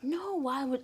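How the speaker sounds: high, loud protesting no; alarmed outcry. Retold or imitated. Teenager crying out to the pastor in alarm, loud. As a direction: burst out in alarm. Panic that mom will know.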